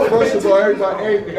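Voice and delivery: laughing through the words. Real, not acted. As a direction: restart while still cracking up. Laughs through restarting his address.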